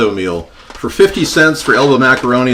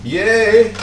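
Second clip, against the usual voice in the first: cheer; 8 semitones higher than usual. Real, not acted. Cheering as the pasta goes in.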